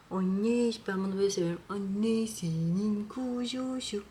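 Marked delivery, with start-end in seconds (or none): 1.7-4.0 drawn-out tender coo. Real, not acted. Cooing to the cat.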